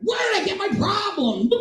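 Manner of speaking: high-pitched silly exclamation; mock bewilderment. Comic whose dad is his sponsor, high mock bewilderment. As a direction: squeal the question in pretend wonder.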